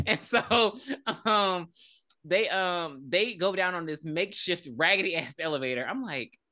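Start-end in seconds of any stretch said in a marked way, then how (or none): none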